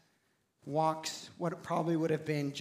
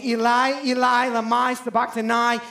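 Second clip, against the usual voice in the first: louder, raised cry of anguish; 6 semitones higher, 10 dB louder than usual. Acted. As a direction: cry it out to the heavens. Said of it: "cried out with a loud voice".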